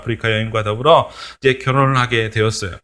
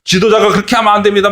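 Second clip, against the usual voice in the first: rising, faster scolding of the leader; mock scolding; 5 semitones higher, faster than usual. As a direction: scold the leader, getting higher. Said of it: "막 야단을 쳤었을 거예요".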